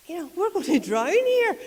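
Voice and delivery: high, loud mock panic about drowning; hammed-up fright, high and loud. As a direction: squeal it like a panicking disciple. Disciples panicking in the storm.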